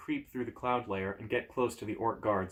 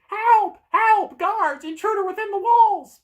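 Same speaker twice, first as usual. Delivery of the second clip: very high, loud screaming alarm; piercing cries raising the alarm; 13 dB louder, slower than usual. Acted. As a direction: scream for the guards. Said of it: "The door starts to scream".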